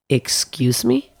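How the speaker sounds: slow, drawn-out offended question; affronted, incredulous comeback; indignant. Retold or imitated. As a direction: stretch it out in disbelief, rising at the end. Indignant reply to a rejection retold.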